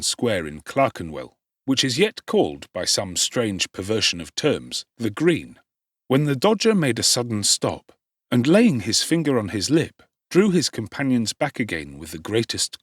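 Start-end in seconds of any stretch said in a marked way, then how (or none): none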